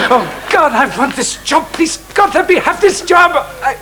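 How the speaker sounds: high, pleading, rising plea to God; frantic prayer. Acted. Teacher begging God for the headship, high and pleading. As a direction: beg heaven for the job, voice climbing.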